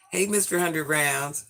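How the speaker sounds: ordinary talk, nothing marked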